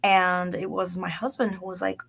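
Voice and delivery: ordinary delivery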